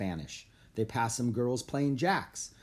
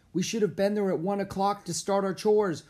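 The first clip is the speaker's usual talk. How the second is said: high-pitched, anxious complaint; worried and worked up; fretting; 9 semitones higher, 4 dB louder than usual. A narrator's reading. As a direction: fret aloud about being late. Jack fretting over being late.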